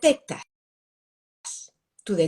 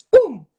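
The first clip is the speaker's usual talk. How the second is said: sharp loud pum, much louder; loud mock punch sound; punch sound effect; 4 semitones higher, 11 dB louder than usual. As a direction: bang out the punch noise. Imitating a punch.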